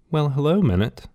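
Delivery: fake sweet greeting. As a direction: put on a charming smile. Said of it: "Sarah smiled at the man".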